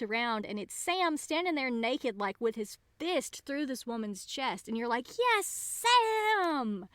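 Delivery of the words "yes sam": high-pitched, drawn-out mock cheer; retold cheer. Fan retelling the moment Sam kills the Maenad, high retold cheer. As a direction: cheer like a thrilled viewer.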